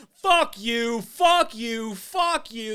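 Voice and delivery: shouted high and loud, over and over; mock quitting rant; imitated yelling. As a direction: yell it while quitting the job. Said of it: "He's just like".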